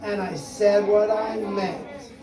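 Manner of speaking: slow, loud chanted refrain; slow recited refrain. Pretend. Leading children in the refrain, slow and loud. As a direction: lead the kids through it slowly.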